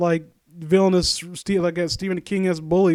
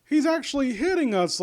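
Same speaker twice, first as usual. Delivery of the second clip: higher-pitched whiny mockery of the cowering kids; mocking the bullies in a higher, whining voice; mock scared bullies; 7 semitones higher than usual. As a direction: whine it like a helpless coward. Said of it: "they're like".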